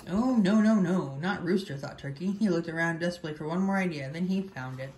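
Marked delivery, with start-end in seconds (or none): 0.1-1.8 a touch of panic, dragging out the no's; dismayed. A narrator's reading. Turkey hearing rooster may be dinner, dismayed.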